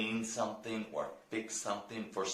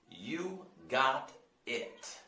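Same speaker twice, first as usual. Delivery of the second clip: slow, drawn-out "You got it." with a D-like t; slow model; 5 semitones higher, much slower than usual. Shown as a demonstration. Teacher models slowly for students to repeat. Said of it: "Try to, try to repeat that".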